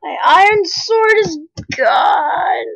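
slow, high, loud wail over the lost sword; wailing loss. Real, not acted. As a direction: cry it out to the viewers, slow and loud. Lost all items after death.

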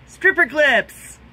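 sudden loud, high, drawn-out exclamation; triumphant recall. Real, not acted. Vlogger remembering what they are called, triumphant shout. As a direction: blurt it out as it comes back to you.

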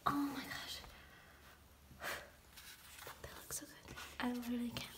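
breathless whispered oh my gosh; hushed, starstruck whisper; awed whisper. Real, not acted. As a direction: whisper it in disbelief. Whispering over the photos.

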